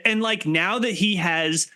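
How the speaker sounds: ordinary talk, nothing marked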